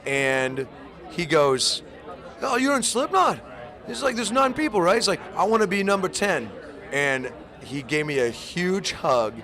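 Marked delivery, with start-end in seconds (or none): 2.4-6.5 high, excited mock [name]. [name] greeting the [name] drummer, in a high, eager mock voice.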